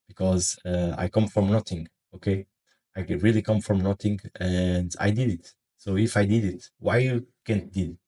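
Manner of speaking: ordinary talk, nothing marked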